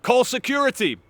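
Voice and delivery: high, loud order for security; mock alarm. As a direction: shout it like a panicking official. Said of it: "They're like".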